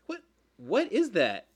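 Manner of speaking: slow, stunned what is that; incredulous. Real, not acted. Incredulous reaction to owner quote.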